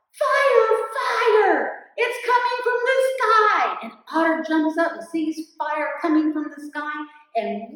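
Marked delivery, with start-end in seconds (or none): 0.1-3.8 high, very loud cry of alarm; alarmed yell. Acted. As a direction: yell a warning to wake the camp. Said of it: "And he yells".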